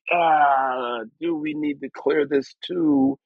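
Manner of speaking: high, slow fretting whine; a mock anxious groan about clearing samples; worried label. Label staff fretting over clearing samples, high slow whine. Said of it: "where it's like".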